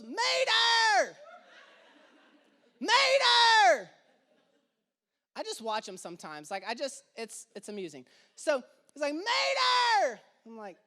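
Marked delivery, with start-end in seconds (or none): 0.0-3.9 put-on high, loud child wail for a dropped toy; child's yell. Toddler yelling for his dropped Mater car. 9.1-10.2 put-on high, loud toddler cry; child's yell. Toddler calling for his fallen toy truck.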